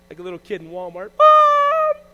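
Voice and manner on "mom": put-on high, loud childish shout; kid yelling mom. Little kid lost in Walmart, loud high shout for mom.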